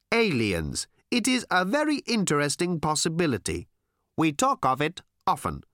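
high, measured alien voice; an alien stating facts with odd precision; earnest alien visitor. Acted.

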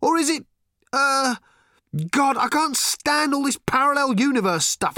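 exasperated and confused, calling out; flustered Arthur. A narrator's reading.

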